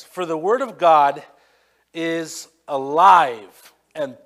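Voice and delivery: booming pulpit reading; scripture reading. Acted. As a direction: proclaim the verses loudly. Reads scripture aloud, preaching.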